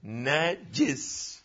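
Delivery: "ونجس" as wa na-jis, slow, soft and low; kasra on jim. Shown as a demonstration. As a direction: draw out "ونجس" with an i after the jim. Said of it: "والحركات الثلاث في الجيم". («ونجس» بفتح النون وكسر الجيم، ببطء)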